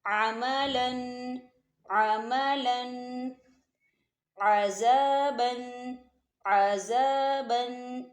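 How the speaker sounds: अमलन and अज़ाबन drawn out slowly, Ain from the throat; slow Quranic recitation. Shown as a demonstration. Teacher reciting Quran examples for students, slow. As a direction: recite each word twice, slow and careful. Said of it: "आगे देखें".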